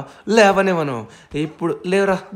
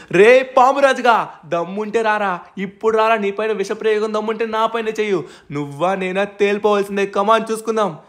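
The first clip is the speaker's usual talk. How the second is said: taunting challenge; 6 semitones higher than usual. Acted. Challenging the snake king.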